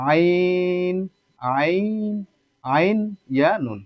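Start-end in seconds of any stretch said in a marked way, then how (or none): 0.1-2.1 আইন with the ai glide held long; drawn-out, higher আইন; elongated glide. Shown as a demonstration. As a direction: stretch আইন on a raised, steady note. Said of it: "কয় আলিফ পরিমাণ উচ্চারণ করবেন?".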